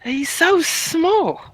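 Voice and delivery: slow, high, tender coo. Acted. Cooing over the baby.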